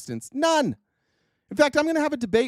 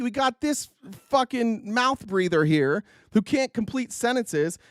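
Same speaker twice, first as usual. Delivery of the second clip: ordinary talk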